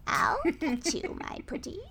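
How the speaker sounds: high cackling witch voice; Wicked Witch. Pretend. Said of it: "Wicked Witch cackle".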